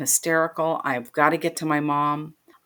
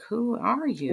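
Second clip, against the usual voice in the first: confused mother; 7 semitones higher, 3 dB quieter, slower than usual. Retold or imitated. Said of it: "she's looking at me like".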